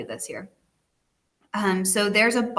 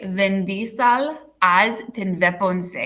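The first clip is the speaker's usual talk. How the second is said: mock Schwarzenegger-style accent, slow and deliberate; slow foreign accent with v for w; slower than usual. Shown as a demonstration. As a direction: put on a heavy Arnold-like foreign accent. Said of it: "some sort of foreign accent".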